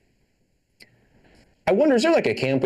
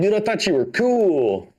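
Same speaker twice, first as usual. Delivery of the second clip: mock disappointed; 7 semitones higher, slower than usual. Dad's disappointed reaction retold.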